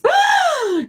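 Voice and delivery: sharp mock panic gasp; a big theatrical gasp. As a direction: gasp in pretend alarm.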